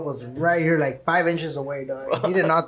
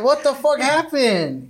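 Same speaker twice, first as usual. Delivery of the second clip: confused shock; 10 semitones higher, slower than usual. Retold or imitated. Waking up to screaming, confused.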